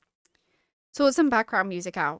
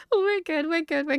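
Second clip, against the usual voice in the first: laughing; 5 semitones higher than usual. Real, not acted. Laughing after the stream mishap.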